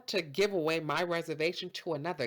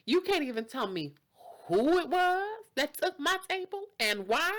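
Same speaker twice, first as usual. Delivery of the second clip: acted indignant demand; 10 semitones higher, 4 dB louder than usual. Customer demands to know who.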